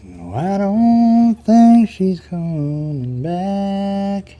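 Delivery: drawn-out pretend chat with a buddy; mock catch-up with a buddy. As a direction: play it like a lonely guy greeting a long-lost friend. Chatting to an imagined old buddy.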